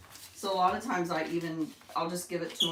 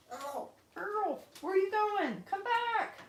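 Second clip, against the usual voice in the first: high call to bird; 14 semitones higher, slower than usual. Real, not acted. Calling Earl back.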